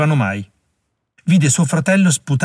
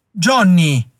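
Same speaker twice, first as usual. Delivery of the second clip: higher, louder anguished cry of the name; despairing cry; 5 semitones higher, 3 dB louder than usual. A narrator's reading. Harry crying out as his brother dies in his arms, despairing. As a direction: call him back as he dies.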